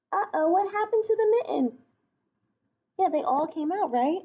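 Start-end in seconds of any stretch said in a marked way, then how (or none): none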